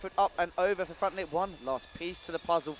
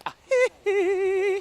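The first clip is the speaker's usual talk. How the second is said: high laugh; 9 dB louder than usual. Real, not acted. Laughing at a rider's trick.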